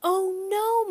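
high, loud dismayed cry; distressed mother's exclamation. A narrator's reading. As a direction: cry out in alarm. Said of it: "my mother cried".

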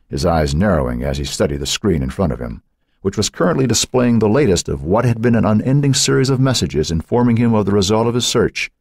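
ordinary delivery

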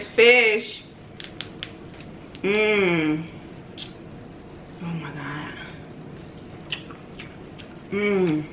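eating with fingers; talking between bites; mouth full, chewing. Real, not acted. Seen 2: Picks fish apart by hand, fingers in mouth, chewing at 3-4s.

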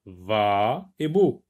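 va, et, beau, slow and separate; split into syllables. Shown as a demonstration. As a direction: cut lavabo into its syllables slowly. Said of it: "lavabo, on l'a sectionné". (syllabes détachées lentement)

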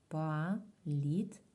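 Палітра in three slow, separate syllables, "па - літ - ра"; split into syllables. Shown as a demonstration. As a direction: break Палітра apart with a clear pause after each syllable. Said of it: "Ділимо на склади". (Палітра повільно, по складах: па - літ - ра)

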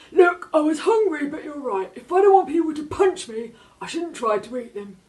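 high, loud, odd cartoon shark voice; a big shark owning up; sheepish shark. A narrator's reading. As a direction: shark admitting it was wrong to the girl.

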